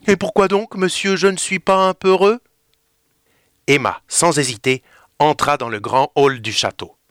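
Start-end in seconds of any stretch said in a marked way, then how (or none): 0.1-2.4 higher, teasing mimicry of his words; mocking. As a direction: throw his own line back at him. Emma se moque de Théo. (Emma se moque, plus aiguë, imitant Théo)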